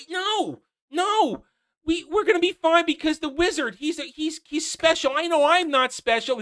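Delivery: high, hammed-up little girl insisting; mocking Nori. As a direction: gush it out naively. Mocking Nori's speech.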